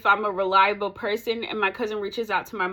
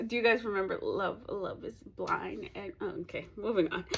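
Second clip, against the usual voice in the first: much softer, trailing-off aside; quiet aside; 3 semitones lower, 11 dB quieter than usual. Real, not acted. Vlogger drops a tangent to viewers, quiet aside. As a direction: drop the volume and abandon the tangent.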